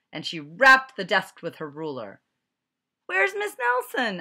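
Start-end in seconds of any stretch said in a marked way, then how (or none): 3.1-4.2 put-on high, loud child voice; kids asking. A narrator's reading. Worried kids asking for their teacher in a high voice. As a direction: ask in a high kid's voice. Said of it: "asked the kids".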